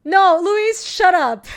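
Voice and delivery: teasing outburst at Luis; mock yell. Real, not acted. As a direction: yell at him half-joking. Yelling at Luis playfully.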